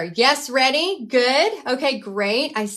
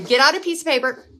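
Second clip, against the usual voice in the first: usual speaking voice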